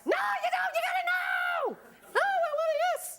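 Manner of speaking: very high laughing cheer yell; high laughing cheerleader shout. Acted. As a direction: whip the crowd up, laughing. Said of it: "dancing with a cheer of".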